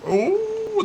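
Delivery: high startled ooh; sharp ooh. Real, not acted. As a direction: flinch at a near miss. Near miss on track.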